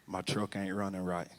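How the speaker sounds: low, hushed remark; uneasy aside. Retold or imitated. Driver to wife about the truck, uneasy aside. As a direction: say it low and under your breath.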